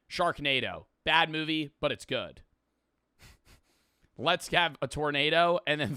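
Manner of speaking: ordinary talk, nothing marked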